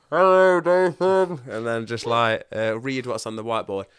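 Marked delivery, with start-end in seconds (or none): 0.1-1.4 loud, high mock group chorus. The whole rehab group greeting Dathan, loud mock chorus. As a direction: call it back like the whole room at once.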